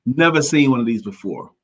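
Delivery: normal talking voice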